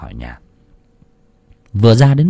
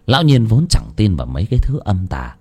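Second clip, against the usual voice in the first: plain reading voice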